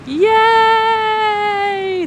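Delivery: high, loud, long drawn yay; excited cheer. Real, not acted. Celebrates blowing out candle.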